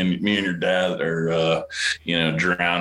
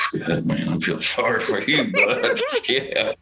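laughing; 5 semitones higher than usual. Retold or imitated. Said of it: "your dad looks at me like".

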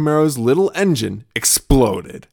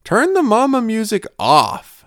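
put-on high slow bossy kid voice; child chiming; 11 semitones higher, 4 dB louder than usual. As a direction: lord it over mama, singsong. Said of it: "deigned to lean his head in and chime".

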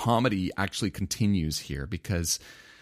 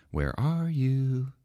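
slow, drawn-out call of God; a slow searching call; 7 semitones higher, much slower than usual. Acted. God calling out in story.

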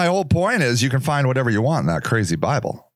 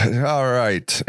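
ordinary delivery

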